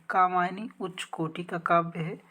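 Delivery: normal talking voice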